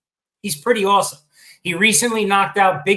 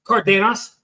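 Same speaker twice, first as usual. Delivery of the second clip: normal talking voice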